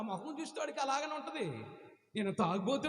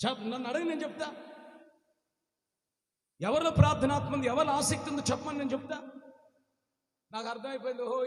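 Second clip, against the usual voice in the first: ordinary talk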